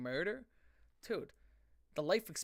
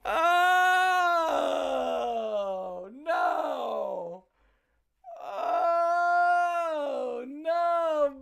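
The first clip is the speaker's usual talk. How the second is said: loud, high cry of disbelief; shouting in horror; 11 semitones higher, 10 dB louder, much slower than usual. Real, not acted. Reacting to a song lyric, yells oh no in real horror. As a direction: yell it like you can't believe your ears.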